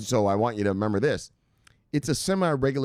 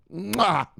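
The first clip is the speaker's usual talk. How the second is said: kiss sound; 10 semitones higher than usual. Pretend. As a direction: blow a big playful kiss. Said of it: "Give him a big old kiss".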